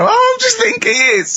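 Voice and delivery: high, loud and breaking with laughter; laughing while quoting. Real, not acted. Quoting Carl to the co-host, still shrieking with laughter. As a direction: push the quote out through real laughter.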